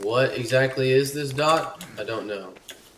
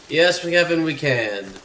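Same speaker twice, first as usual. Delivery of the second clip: loud, higher exasperated reply; raised-voice yes; emphatic answer; 5 semitones higher, 7 dB louder than usual. Real, not acted. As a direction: answer your buddy loudly. Answering the companion.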